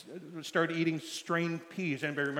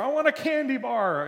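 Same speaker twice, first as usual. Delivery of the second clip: put-on begging three-year-old; 10 semitones higher, 4 dB louder than usual. Three-year-old to dad in grocery store, begging insistently. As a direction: pester like a small child wanting sweets. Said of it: "asking over and over for a candy bar".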